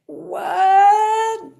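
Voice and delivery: shocked at the five-figure price, squeaky what. Retold or imitated. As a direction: yelp it at the price. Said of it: "I was like".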